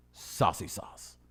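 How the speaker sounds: slow breathy whisper; drawn out and seductive; sultry slow. Acted. Pitching a product name slowly.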